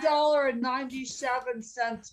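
talking while chewing pizza; mouth full. Real, not acted. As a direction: say it through a mouthful. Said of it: "I'm chewing on".

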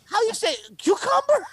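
high squeaky fake Spanish accent on How you say cucumber; mocking imitation. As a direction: parody her, pitch way up. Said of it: "thick Spanish accent".

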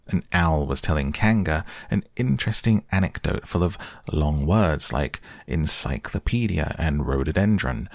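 usual speaking voice

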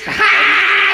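very high, loud crazy greeting; Fran Drescher. Retold or imitated. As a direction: shriek a hammed-up celebrity hello. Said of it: "do her voice as well".

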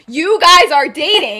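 loud, drawn-out accusation; shocked outburst. Acted. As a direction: act out a stunned, too-loud discovery. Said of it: "Keep your voice down".

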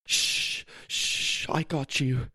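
put-on soft, soothing shushes to a crying baby; calming. A narrator's reading. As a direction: hush her gently, then reassure quietly. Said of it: "calmed her cries".

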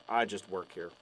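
low, weary shrug of a line; resigned librarian. Acted. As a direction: give up on understanding. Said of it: "And the librarian's like".

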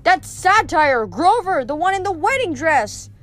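high-pitched impatient explaining; exasperated bellow. A narrator's reading. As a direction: spell it out shrilly for a dimwit. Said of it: "bellowed Clarice".

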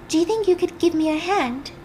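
high, eerily sweet request; blood-covered Rachel calmly asking Teddy for help. Retold or imitated. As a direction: ask sweetly, as if nothing is wrong. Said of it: "calmly standing".

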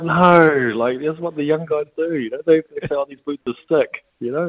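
high, loud exasperated protest; exasperated explaining. Retold or imitated. Son explaining slang to Dad, high loud exasperated protest. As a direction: insist like it's obvious. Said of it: "And I'm like".

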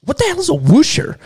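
high, baffled mock outburst. Retelling his roommate's odd word, asking in high disbelief. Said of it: "And I said".

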